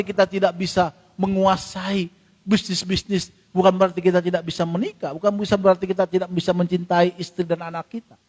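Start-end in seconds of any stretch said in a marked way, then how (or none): none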